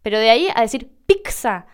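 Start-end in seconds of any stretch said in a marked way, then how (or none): 1.1-1.7 pizza as "picsa", with a punched k sound; mispronounced pizza. Shown as a demonstration. Host baffled by this error, exaggerated mispronunciation. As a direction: hit a k before the s in pizza. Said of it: "como si fuera P I C S A".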